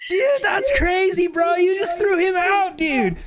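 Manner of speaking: very high, drawn-out bro wail; hammed-up high stoner voice; stoner friends. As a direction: exclaim like an amazed high buddy. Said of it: "and his friends go".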